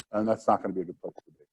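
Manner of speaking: ordinary talk, nothing marked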